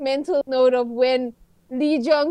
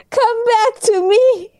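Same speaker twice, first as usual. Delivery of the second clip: high, drawn-out theatrical plea; mock pleading; 8 semitones higher, 4 dB louder, slower than usual. Fan calling to absent Korean actors, mock pleading. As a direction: plead melodramatically, stretching it out.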